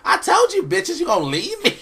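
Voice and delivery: high squealing mock outrage; indignant villain screech. Queen of Fables confronts the crew, indignant high screech. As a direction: screech it like a betrayed diva.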